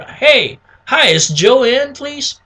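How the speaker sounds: high, slow cheery phone greeting; friendly sales caller. Shown as a demonstration. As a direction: open the call with an upbeat lift. Sample sales call being acted.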